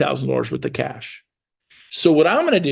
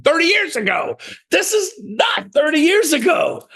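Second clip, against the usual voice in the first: exasperated; 13 semitones higher than usual. Real, not acted. Coach ranting about thirty-day escrows, exasperated. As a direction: pitch it way up and stretch it in disbelief.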